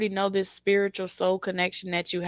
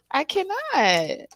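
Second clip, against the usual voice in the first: drawn out, high, disbelieving; slow and higher, as if it's too much; I cannot; 8 semitones higher, slower than usual. Real, not acted. Overwhelmed by the reading.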